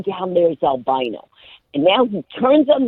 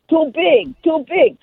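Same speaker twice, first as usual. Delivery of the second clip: higher, dismissive imitation of the Russian pilot; mock Russian accent; 9 semitones higher, slower than usual. Russian pilot refusing the woman, mock Russian accent. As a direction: brush her off in a Russian accent. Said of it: "He goes".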